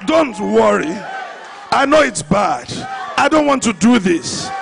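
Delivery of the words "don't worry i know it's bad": ordinary delivery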